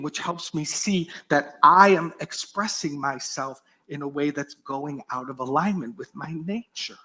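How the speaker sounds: ordinary talk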